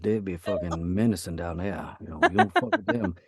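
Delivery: a light Louisiana Cajun accent, fast at the start; menacing swamp-country Cajun voice, lightly done; Cajun Creole accent. Shown as a demonstration. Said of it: "Creole country".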